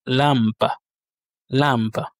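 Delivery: lampa in Russian, stress on the first A; Russian pronunciation. Shown as a demonstration. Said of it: "l'accent tonique se situe sur le".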